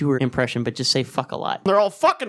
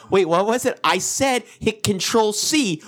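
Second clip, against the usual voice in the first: frustrated yell; 7 semitones higher than usual. Pretend. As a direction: yell it at someone who won't listen. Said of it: "Just yell that at somebody".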